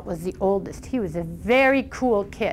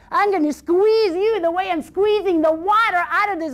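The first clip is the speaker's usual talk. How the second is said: bold threat; 7 semitones higher than usual. A narrator's reading. Boy threatens troll.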